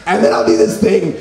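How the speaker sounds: normal talking voice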